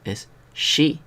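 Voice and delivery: sh, not s, in "shi". Shown as a demonstration. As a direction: say "shi" like the start of Sheila. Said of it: "Hay que hacer sh".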